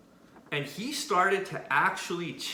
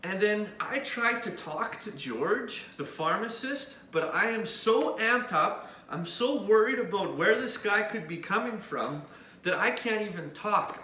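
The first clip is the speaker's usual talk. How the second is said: ordinary delivery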